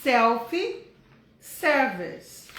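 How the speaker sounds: teacher stretching out Self-service; slow stressed term. Shown as a demonstration. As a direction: spell out Self-service for the class. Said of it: "chama o quê?".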